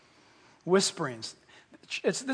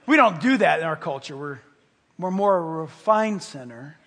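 ordinary talk

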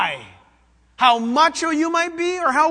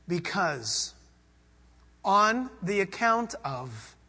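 meditative build; 3 dB louder, slower than usual. Shown as a demonstration. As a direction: start hushed and swell to exultant. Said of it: "To meditate on that would be like this".

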